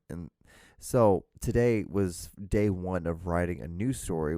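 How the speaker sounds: usual speaking voice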